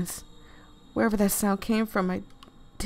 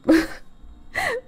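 high broken laugh-cry. Real, not acted. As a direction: laugh that breaks into a sob. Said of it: "I'ma cry".

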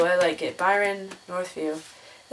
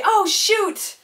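mock dismay; 12 semitones higher, 4 dB louder than usual. Teacher learns photo was sent.

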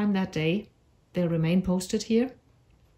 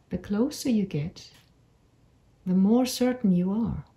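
unhurried meditation voice with long pauses; measured storytelling for a calming visualisation; guided meditation. Acted. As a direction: guide them gently through an imagined walk, phrase by phrase. Leads a guided imagery meditation.

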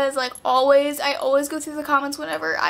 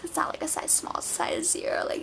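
whispering; 10 dB quieter than usual. Pretend. Whispered joke about dress size.

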